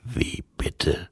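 low, whispery voice of Death; Death questioning. A narrator's reading. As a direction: ask it as Death, hushed and low. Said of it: "fragte Tod".

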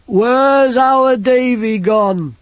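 put-on high, slow, childlike big lad; simple lad voice. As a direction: voice him like a big simple boy. Banjo's voice.

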